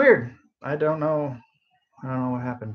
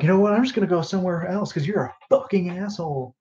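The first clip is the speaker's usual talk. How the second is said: retold outburst, a bit higher and sharper; mock angry snap; 6 semitones higher than usual. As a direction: play the customer snapping back at her. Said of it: "turned around and snapped".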